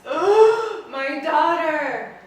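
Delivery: loud, high, long-drawn cry of anguish; hammed-up wail, stretching each word; horrified wail. Father watching his daughter burn, wailing in horror.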